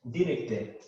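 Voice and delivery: director ending in an open e, not or; Creole pronunciation. Shown as a demonstration. Teacher showing director in Haitian Creole. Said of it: "Tengo que reemplazar O R para E con acento".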